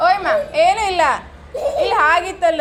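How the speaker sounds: high, loud soothing over crying; loud reassurance. Real, not acted. Parent calming child over crying.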